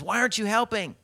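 high, loud indignant demand; angry. Acted. Castaway confronting the man who won't help, angry. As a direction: act out an exasperated, angry castaway. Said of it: "'cause he's angry".

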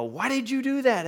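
very high-pitched incredulous question; baffled. Retold or imitated. Pastor retells asking why it was done, high and baffled. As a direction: shoot the voice way up in comic disbelief.

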